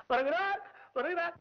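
high, rising herald's call; announcing an arrival. Acted. அமைச்சர் வருவதை உரக்க அறிவித்தல். (உயர்ந்த குரலில் வருகை அறிவிப்பு)

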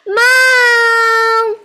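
high, long-held call for Mom; loud yell. Acted. Teacher re-enacts chicken calling mother, drawn-out yell. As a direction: yell out for your mother, stretched out. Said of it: "Does he yell loudly".

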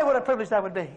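ordinary talk, nothing marked

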